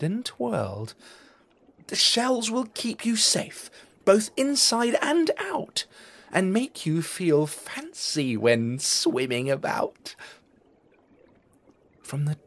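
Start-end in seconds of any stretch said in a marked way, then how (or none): 1.9-10.1 higher, louder character voice for the crabs; crabs' voice. A narrator's reading. Crabs showing off new shells to the families, proud higher voice.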